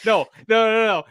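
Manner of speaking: high, loud laughing denial; giggling no no no. Real, not acted. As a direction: laugh it off, protesting. Laughing protest at joke.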